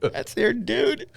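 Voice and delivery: words squeezed through laughter; laughing. Real, not acted. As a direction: say it while cracking up. Laughing repeat of joke.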